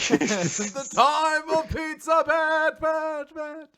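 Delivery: high, slow shout with laughing echo; echoing announcer. Pretend. Host hams up the Pizza Bet title with an echo shout.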